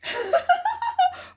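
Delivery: high-pitched giggling laugh; high, rippling laughter. Real, not acted. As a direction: burst out in a high cackling giggle. Laughs after joking Holla.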